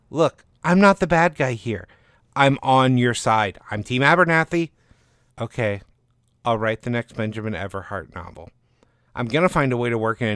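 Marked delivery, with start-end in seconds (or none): 0.1-4.7 higher, louder insistent agent voice; defensive agent. Acted. Agent reassuring the author, higher and louder. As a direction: insist you're on her side.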